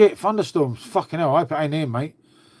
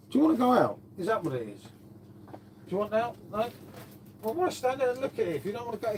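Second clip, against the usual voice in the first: quietly asking the dog; 5 semitones higher, 9 dB quieter than usual. Real, not acted.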